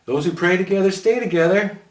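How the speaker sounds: bold. A narrator's reading. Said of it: "Roman offered boldly".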